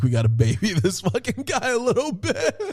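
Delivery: high, laughing mock band voice; laughing imitation. The band amused by the starstruck fan, laughing high. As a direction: giggle through it like a bemused rock star.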